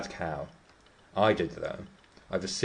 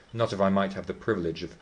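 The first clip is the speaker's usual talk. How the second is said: usual speaking voice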